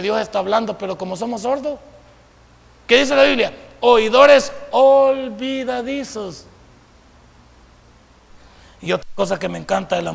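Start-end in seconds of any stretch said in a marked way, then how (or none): 3.8-6.5 very slow, stretched syllables; drawn-out emphasis. Real, not acted. Preacher quotes the Bible to forgetful listeners, drawn-out emphasis. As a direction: draw each word out for the congregation.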